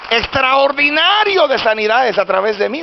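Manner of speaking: high, drawn-out preaching at full force; prédica exaltada. Real, not acted. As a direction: proclaim it to the congregation, slowly. (prédica a toda voz)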